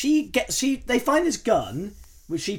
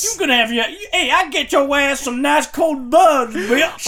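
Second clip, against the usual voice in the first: put-on Ma's American accent; 4 semitones higher, 6 dB louder than usual. Host retrying Ma's accent after being mocked, hammed-up American. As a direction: ham up a boozy American mum. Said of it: "she doesn't sound like that".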